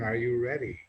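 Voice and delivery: usual speaking voice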